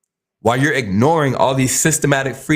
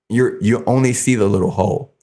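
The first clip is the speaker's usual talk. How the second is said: ordinary talk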